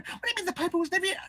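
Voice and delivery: high, fast incredulous question; disbelieving wife. Acted. Wife disbelieving her husband, high and fast. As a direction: rattle it off in disbelief.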